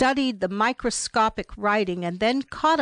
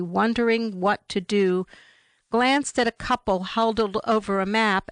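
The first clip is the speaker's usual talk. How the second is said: usual speaking voice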